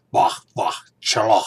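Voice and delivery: harsh throaty "Bach, bach, chala", loud and rasping from the throat; guttural old Klingon. Shown as a demonstration. Showing fans the Klingon he prefers, guttural. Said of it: "I prefer".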